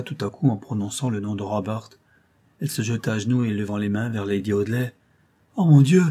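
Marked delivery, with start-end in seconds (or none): 5.6-6.0 high, loud exclamation; sudden anguished outburst; horrified cry. A narrator's reading. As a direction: cry out in horror. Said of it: "s'écria-t-elle".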